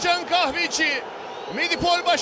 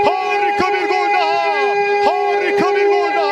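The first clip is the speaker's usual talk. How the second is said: screaming football commentator; 4 dB louder than usual. Real, not acted. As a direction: stretch the goal call out loud. Goal call in live commentary.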